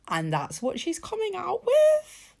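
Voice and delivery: high, loud disbelieving cry; incredulous outburst. Real, not acted. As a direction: shout your disbelief at how absurd it is. Exasperated rant about an MP.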